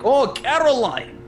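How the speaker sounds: high, slow Russian accent; exaggerated Russian accent calling out. Shown as a demonstration. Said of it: "I got to do a Russian accent for that".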